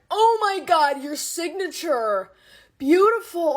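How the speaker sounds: high, loud gushing praise; gushing clerk. Retold or imitated. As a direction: gush over the signature. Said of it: "she was like".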